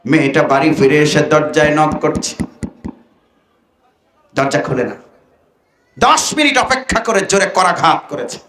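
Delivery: ordinary talk, nothing marked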